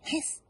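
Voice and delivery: high, soft hissed hiss; soft high goose hiss. Acted. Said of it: "said the goose".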